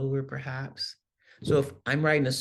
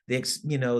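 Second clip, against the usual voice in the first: ordinary talk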